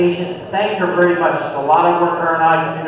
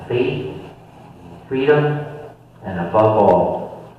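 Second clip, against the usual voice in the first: prayer; 6 semitones lower than usual. Acted. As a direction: lead the closing prayer gently. Closing prayer at meeting.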